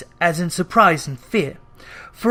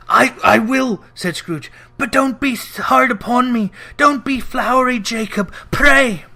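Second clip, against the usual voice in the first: higher, stammering plea; frightened Scrooge pleading; 6 semitones higher, 3 dB louder than usual. Acted. Scrooge begging Marley's ghost for mercy, higher and stammering. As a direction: beg the ghost to go easy.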